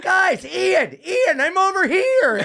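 high, drawn-out call; mock calling out. Sam calling friends over, high drawn-out shout. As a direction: wave them over by voice.

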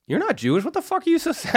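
mock exasperation at her tears; baffled mock outrage. Retelling baffled reply to crying date.

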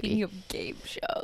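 soft, voiceless whisper; whispered prompt. Real, not acted. Co-host nudging her friend back to game shows, whispered. Teasing prompt echoing co-host's segue.

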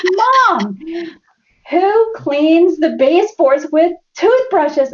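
high, slow incredulous son; son teasing. Retold or imitated. Son questioning mom's cleaning habits, mock incredulous. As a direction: drag it out in disbelief. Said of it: "And he goes".

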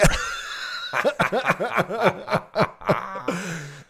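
high-pitched real laughing fit; long laugh. Real, not acted. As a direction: crack up at your own joke, long and high. Laughs at own punchline.

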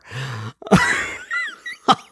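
sudden high-pitched giggle; real high giggle. Real, not acted. Host laughing at her Cheetos joke, real high giggle. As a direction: burst into a squeaky laugh.

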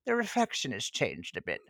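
wistful old-lady impression; Old Rose. Looking into her old mirror, wistful old-woman voice. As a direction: muse like an elderly film heroine. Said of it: "looks into the mirror and she goes".

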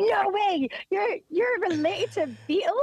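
high, starstruck American official voice; impressed customs officer. Retold or imitated. Friend's Liverpool story, officer amazed by the Beatle link, high excited voice. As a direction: gush like a dazzled border guard.

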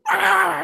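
sudden high, loud yelled "Ah!"; mock scream of an angry skater in his face; imitated scream. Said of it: "he just starts screaming at me".

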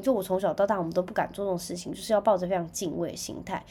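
ordinary talk, nothing marked

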